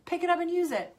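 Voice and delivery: high, insistent lecturing; a mock-pushy parent, high; pushy parent mimic. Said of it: "be like".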